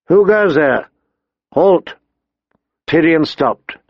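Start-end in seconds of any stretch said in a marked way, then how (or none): 0.1-1.9 sharp raised sentry call; calling out a challenge. A narrator's reading. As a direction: shout a challenge from the dark. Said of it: "a muffled voice called out a challenge".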